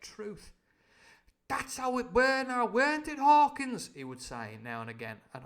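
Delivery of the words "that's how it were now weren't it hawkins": loud high pirate voice; Long John voice. Acted. Long John fishing for Jim's agreement, loud and high. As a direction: boom it out like a jolly pirate cook.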